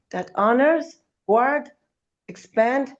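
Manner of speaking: normal talking voice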